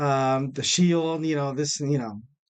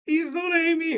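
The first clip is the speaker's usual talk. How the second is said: squeaky mock begging, much higher; mock plea; 14 semitones higher than usual. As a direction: whine it like a fake-desperate plea. Mock plea, then I'm just kidding.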